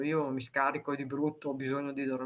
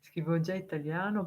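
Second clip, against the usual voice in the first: ordinary talk, nothing marked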